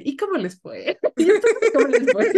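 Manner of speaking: high, loud question breaking into laughter; laughing mock innocence. Little sister teasing her family after the ride, laughing loudly. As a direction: ask sweetly and crack up laughing. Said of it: "Y yo nada más de".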